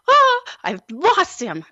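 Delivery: high, sudden gasp of alarm; panicked realization. Retold or imitated. Mom reliving losing her son, high alarmed cry. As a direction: let the panic jump up into it.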